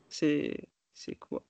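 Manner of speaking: ordinary delivery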